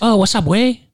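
put-on loud, high-pitched burst of Mexican accent; mock Mexican accent. Host testing a Mexican accent on co-host, loud mock accent. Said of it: "Mexican accents, I think people can do still".

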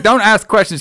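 high, insistent mock pleading; acting out a frantic order; urgent command. Sergeant cutting off her questions.

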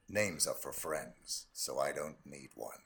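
low, quiet, slow assassin voice; cold killer calm, very low; low cool menace. Acted. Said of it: "Agent forty-seven".

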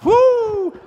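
high, loud startled woo; whooping in mock panic; mock alarmed whoop. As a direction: yelp as the big dude emerges. Big guy gets out of car.

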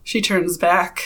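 normal talking voice

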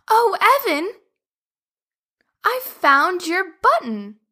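high, bright discovery; excited call to Evan. Acted. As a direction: call out the find with delight. Sophie calls out excitedly.

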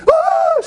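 put-on old lady screaming in fright; startled scream. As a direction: shriek like food just flew. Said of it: "She screamed".

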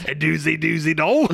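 silly Cockney nonsense with a laugh; badly faked Cockney accent; comic Cockney babble; mock Cockney. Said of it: "Don't go Cockney".

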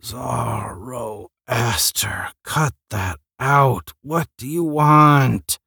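slow, groggy growl rising into an annoyed question; sleepy growl. Acted. Sai woken by the hotel chime, slow groggy growl. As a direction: grumble it half-asleep, dragging the words.

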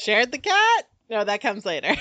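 high, loud startled question; surprised. Retold or imitated. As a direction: catch the slip in alarm. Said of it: "I'm like".

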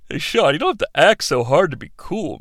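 normal talking voice

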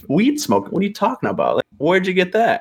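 retold disbelief. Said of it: "I was like".

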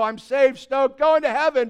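loud, high mock elation; gleeful new convert. Preacher mimics a newly saved believer, loudly stoked. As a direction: imitate someone thrilled to be saved.

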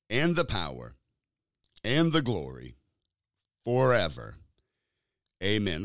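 slow, measured congregational prayer; slow prayer recitation. Shown as a demonstration. Pastor leading listeners in prayer, slow recitation. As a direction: lead the church through the prayer line by line. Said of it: "I'll have you say it with me today".

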